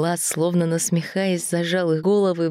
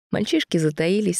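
usual speaking voice

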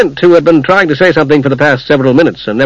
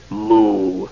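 low, soft mutter to himself; 5 semitones lower, 7 dB quieter than usual. A narrator's reading. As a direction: grumble it under your breath. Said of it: "dwarf was still muttering".

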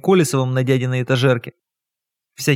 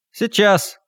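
bitter; 6 semitones higher than usual. Acted. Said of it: "горько произнёс".

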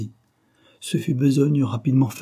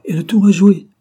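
much higher and louder, gloating; triumphant outburst; 10 semitones higher, 11 dB louder than usual. A narrator's reading. Ossip gloats to the girl over his trap, loud. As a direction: crow over the done deal.

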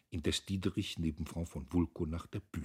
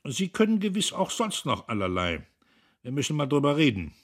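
loud, high lordly condescension; booming patron being gracious; 8 semitones higher, 8 dB louder than usual. A narrator's reading. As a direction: grant it grandly, voice raised. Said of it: "Wulko äußerte gnädig".

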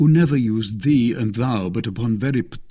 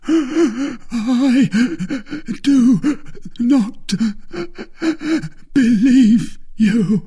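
high, loud, halting sobs with long gasps; dying cry; 14 semitones higher, 5 dB louder, much slower than usual. A narrator's reading. Expiring soldier refusing to believe the oath, agonised crying. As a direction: force each word out through dying gasps. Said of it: "cried the soldier".

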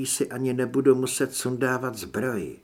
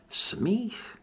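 ordinary delivery, nothing marked

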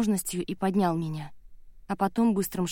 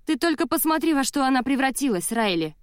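high-pitched, outraged appeal; raised, indignant voice; indignant exclamation; 6 semitones higher, 4 dB louder than usual. A narrator's reading. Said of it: "возмущённо воскликнула она".

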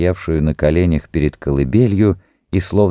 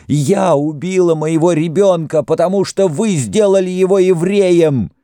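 very high, frenzied confession; inhuman scream; 12 semitones higher than usual. A narrator's reading. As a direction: cry out the confession, wild with grief. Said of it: "закричала нечеловеческим голосом Сара". (исступлённый крик высоким голосом)

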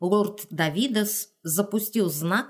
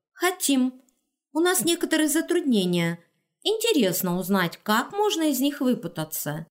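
put-on eager young dryad; 7 semitones higher than usual. Dryad voiced high.

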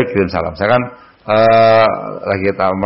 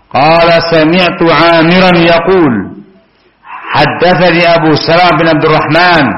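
bacaan sanad hadis; 5 semitones higher, 4 dB louder, slower than usual. Acted. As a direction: bacakan rantai perawi seperti melantunkan.

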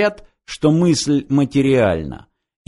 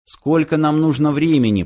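plain reading voice

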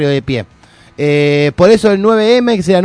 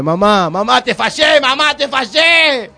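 mock wail; 7 semitones higher, slower than usual. Mock lament to mother.

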